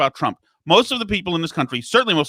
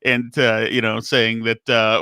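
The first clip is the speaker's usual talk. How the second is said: usual speaking voice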